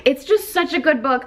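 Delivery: high, gushing repeat; excited. Real, not acted. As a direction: swoon over the book. Repeats praise, second higher.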